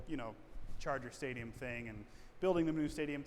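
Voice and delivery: usual speaking voice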